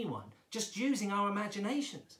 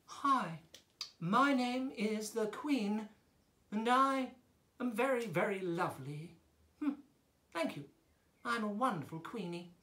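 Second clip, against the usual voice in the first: high-voiced queen; 4 semitones higher than usual. Shown as a demonstration. Teacher introducing herself as the queen, high queenly voice. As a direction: play a slightly raised queen's voice. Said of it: "The queen talks high up here".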